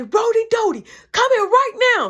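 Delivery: high, loud calling voice; mock summons. Mom calling her older son over, loud high summons. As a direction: summon him from across the house.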